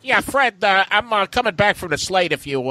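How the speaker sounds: high, put-on cartoon voice; early Barney voice. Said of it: "at the first, he's like".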